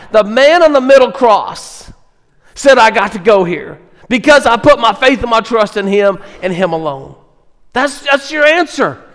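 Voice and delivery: high, loud fervent sermon delivery; full-force preaching. Real, not acted. Preacher urging the congregation toward assurance, loud and fervent. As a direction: drive it home to the congregation.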